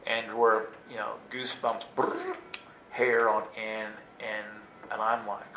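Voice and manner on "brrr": high, soft trilled shiver; shivery brrr. Retold or imitated. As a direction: imitate goosebumps with a brrr. Imitates goosebumps rising.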